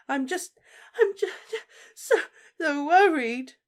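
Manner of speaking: very high, loud crying voice; mother weeps her worry, high and loud; tearful. Acted. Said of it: "tears spilling out of her eyes".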